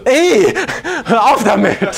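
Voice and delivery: high, loud protest through laughter; laughing high Ey, stop it. Retold or imitated. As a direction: push him off laughing loudly. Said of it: "Und sie so".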